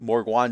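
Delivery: "Morgwan" said MORG-WAHN, with a W; altered pronunciation. Shown as a demonstration. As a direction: put a W sound into "Morgwan", ending in "wan". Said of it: "put a W in there".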